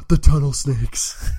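gasp, then loud, drawn-out awe; high, slow theatrical gasp of discovery; mock shock. Player reacting to gang name, mock shock.

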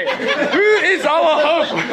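loud, high, drawn-out question through laughter; laughing loud restart. Real, not acted. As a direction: belt out the question while cracking up. Flustered, loudly resuming sermon amid laughter.

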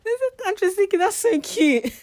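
high, loud squeal through laughter; giggly, high and loud; amused cooing. Real, not acted. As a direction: gush about something cute, very high-pitched. Amused by her own child impression.

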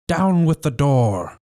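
slow, high shouted order; cried out. A narrator's reading. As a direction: bark the order slowly at the door. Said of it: "he cried".

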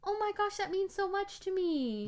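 high, heartfelt gratitude; moved by the gift; tender, high thanks; touched. Real, not acted. Touched by surprise ornaments.

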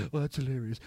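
low, soft smug chuckle voice; mock the smug anchor, quiet and low; Don Lemon impression. Mocking a TV host on CNN, a low smug quip. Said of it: "Don Lemon just starts laughing like".

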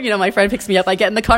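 ordinary delivery

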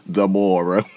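"Deborah" as duh-BOR-uh through laughter; imitated pronunciation. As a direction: giggle out "Deborah" with a long BOR. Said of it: "but she pronounced it Deborah".

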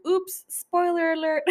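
high, laughing sing-out; playful oops. Real, not acted. Owning up to a spoiler for viewers, high and laughing. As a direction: let it break into a laugh, pitched high.

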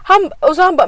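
ordinary delivery

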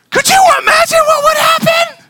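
full-force preacher's shout; preaching shout. Real, not acted. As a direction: blast it at the congregation. Preacher's climactic outburst.

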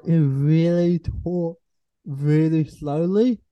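drawn-out, slow words; mimicking slow talkers. Student describing people who over-explain, very slowly. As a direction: stretch it like someone over-explaining.

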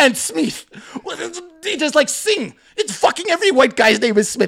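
hammed-up incredulous complaint; Hassan freaking out. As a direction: imitate a coworker's wound-up rant. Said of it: "He's freaking out".

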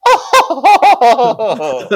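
high, loud mock-shocked oh; mock gasp. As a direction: gasp like you're offended, hand to mouth. [name] mock-gasping, hand to mouth.

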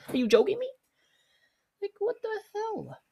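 very high-pitched disbelief; incredulous squeal. Acted. Cashier venting over free burgers, incredulous squeal. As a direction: squeak it out, rising into falsetto.

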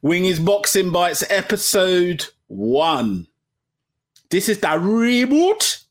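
slow, drawn-out show intro; grand podcast intro voice; announcer intro. Acted.

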